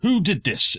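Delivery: a guard spinning round, demanding answers; startled guard. Acted. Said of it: "turn around like this and say".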